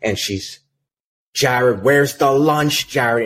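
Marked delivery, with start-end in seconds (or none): 1.3-3.3 slow, higher, louder mock yell; mock scream. Office manager yelling at the rep over lunch, mock scream. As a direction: stretch the demand out like a shrill yell. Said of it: "And she starts screaming".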